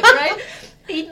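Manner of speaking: ordinary talk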